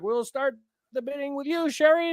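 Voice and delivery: lightly imitated host opening the bidding; Bob Barker. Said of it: "Bob Barker's like".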